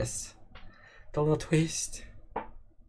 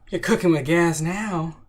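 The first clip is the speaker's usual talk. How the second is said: hyped up; 5 semitones higher, 5 dB louder than usual. Real, not acted. Hyped approval of name.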